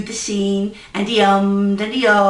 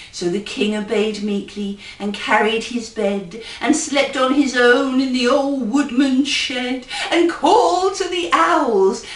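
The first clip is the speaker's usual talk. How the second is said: plain reading voice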